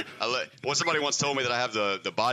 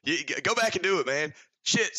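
higher, fast self-berating yell; self-scolding; 7 semitones higher than usual. Retold or imitated. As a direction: scold yourself in frustration. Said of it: "beating myself up".

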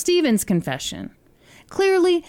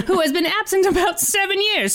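put-on old-timey newsreel voice, mid-Atlantic. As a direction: read it like a 1930s newsreel. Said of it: "should I do it in an old-timey voice".

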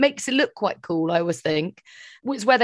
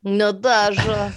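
Russian-ish Natasha; much slower than usual. Retold or imitated. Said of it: "Danny Kvyat calls me Natasha".

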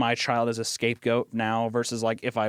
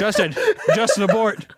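high, loud call through laughter; laughing alarm; 10 semitones higher, 6 dB louder than usual. Real, not acted. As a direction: beg the producer to abort, cracking up. Laughing, calls producer to abort.

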